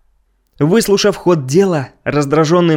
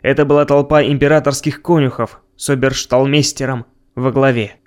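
ordinary delivery

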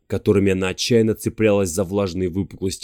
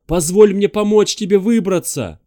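loud high pleading shout; 10 semitones higher, 6 dB louder than usual. Acted. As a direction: shout the offer down to her. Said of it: "снова прокричал".

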